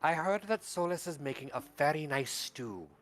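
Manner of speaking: a quick, hushed kindly granny; lightly voiced grandmother, hurrying a little. Granny Matilda repeats herself.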